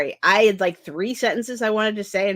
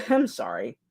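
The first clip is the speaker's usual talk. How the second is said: ordinary talk, nothing marked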